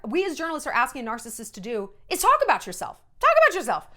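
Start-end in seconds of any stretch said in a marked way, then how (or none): 3.2-3.9 loud, high mock demand. Mocking journalists asking a narcissist to talk. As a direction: parrot the request with scorn.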